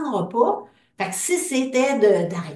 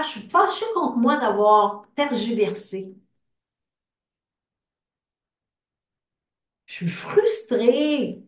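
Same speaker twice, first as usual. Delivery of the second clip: guided tapping script. Acted. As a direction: lead the group through each feeling, line by line. Leads an EFT tapping script.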